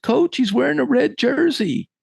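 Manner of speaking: put-on very high-pitched kid voice; young player's protest. Player explaining his missed tackle to coach, high and earnest.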